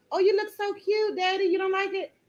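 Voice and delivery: high-pitched cooing to a little one; doting. Real, not acted. Fussing tenderly over a child.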